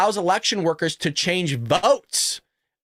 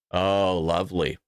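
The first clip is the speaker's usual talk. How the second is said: very low, deadpan sarcasm; sarcastic; 4 dB quieter than usual. Real, not acted. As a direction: drop way down for dry fake approval. Sarcastic reaction to the news.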